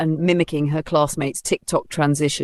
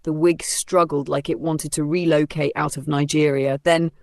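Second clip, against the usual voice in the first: plain reading voice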